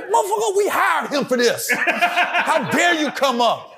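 high, scolding laughed-through tirade; mock chew-out, laughing; snapping at him. Brothers in the hallway scolding the heckler, laughing. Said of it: "snapping on him in the hallway".

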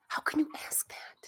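hushed, appalled whisper; whispered reproach to a crewmate; scandalized whisper. Acted. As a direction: hiss it under your breath, shocked. Scolding a rude question aside.

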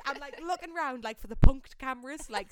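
normal talking voice

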